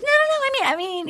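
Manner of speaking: high-pitched, flustered retraction; mock backpedalling. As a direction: imitate someone hurriedly taking it back. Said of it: "She's like".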